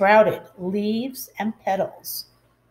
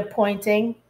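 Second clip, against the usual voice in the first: ordinary delivery, nothing marked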